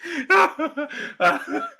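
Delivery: real teary laughing fit; laughing to tears. Real, not acted. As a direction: laugh helplessly, running out of air. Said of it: "you got me in tears".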